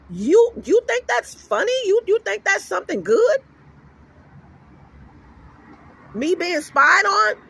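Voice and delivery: high, incredulous questions, sharply rising; indignant challenge. Retold or imitated. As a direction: retell it as an affronted confrontation. Retold confrontation of grinning husband.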